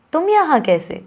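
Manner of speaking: surprised tone. Shown as a demonstration. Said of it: "मुख्तलिफ tone में बोल के दिखाती हूँ".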